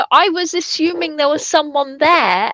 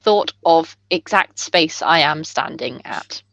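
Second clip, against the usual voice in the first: halting telepathic character; 9 semitones lower than usual. Acted. Player as character sending a thought, low and halting. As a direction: drop low and grope for each word.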